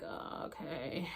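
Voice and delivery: low, slow and soft, unimpressed; deadpan mumble. Retold or imitated. To the screaming room, slow soft unimpressed mumble. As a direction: mumble it flat, like nothing's happening. Bored reaction to others.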